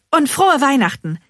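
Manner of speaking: high, loud, hasty good wishes; hurried. A narrator's reading. Bean hastily placating the workman, hurried. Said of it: "fügt Bean eilig hinzu".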